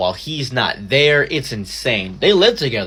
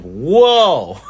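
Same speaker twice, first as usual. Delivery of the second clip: mock whoa; 3 semitones higher, 5 dB louder than usual. Reacting to juicy divorce news.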